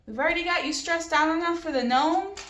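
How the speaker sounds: high, loud joking jab; teasing coworker. A narrator's reading. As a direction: rib him playfully. Stranger teasing dad.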